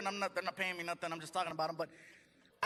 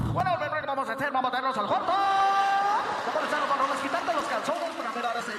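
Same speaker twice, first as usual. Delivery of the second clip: loud, rapid hyped DJ with one long stretched cry; radio host voice; 4 semitones higher, 7 dB louder than usual. Retold or imitated. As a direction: announce bedroom plans like a live broadcast. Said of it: "talking like that to his wife".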